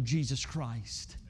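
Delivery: very slow, low and soft; reverent hush. Real, not acted. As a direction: lay the name down with awe. Reverent climax of the appeal.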